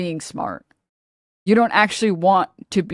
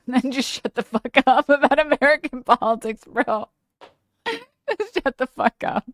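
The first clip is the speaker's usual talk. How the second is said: laughing through it, higher-pitched; 6 semitones higher than usual. Real, not acted. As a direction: crack up while telling them off. Laughing rant at centrists.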